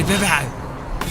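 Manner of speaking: ordinary talk, nothing marked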